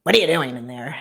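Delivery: loud retold holler, first word hardest; thundering mom. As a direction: imitate a mother calling out angrily from the hallway. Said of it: "I heard my mom thunder from the hallway".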